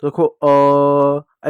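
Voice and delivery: very slow stretched अ; long drawn a. Shown as a demonstration. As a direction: draw out the sound अ slowly. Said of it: "लंबा उच्चारण". (अ को बहुत लंबा खींचकर)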